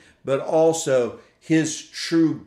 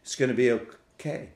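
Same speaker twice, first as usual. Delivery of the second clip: put-on soft, hushed reassurance to a hurt child; father soothing his crying child, softly; gentle comfort; 2 semitones lower, 7 dB quieter than usual. Father comforting his crying infant, gentle and soft. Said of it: "I would comfort them and say".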